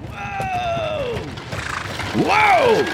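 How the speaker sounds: high call to halt the horses. A narrator's reading. Driver calls his horse team to stop, high. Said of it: "the wagon driver called to his team".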